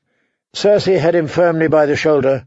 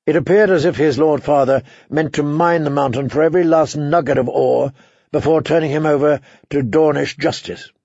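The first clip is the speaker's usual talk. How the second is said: usual speaking voice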